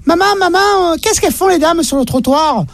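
put-on high little boy voice, far above his own; squeaky little boy asking his mum; child voice.

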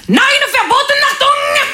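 very high, barked mock German accent. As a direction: shout it in a hammy German accent. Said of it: "c'est mon accent vosgien qui remonte".

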